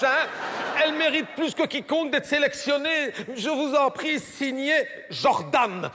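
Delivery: put-on high, pleading child's voice; young boy. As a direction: plead slowly like a boy dictating a letter. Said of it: "c'est son gamin qui écrit". (voix de gamin suppliant, aiguë)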